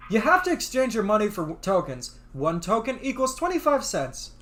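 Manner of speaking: normal talking voice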